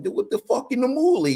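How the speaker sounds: rough mock Italian accent, pitched high. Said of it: "he's doing that Italian accent".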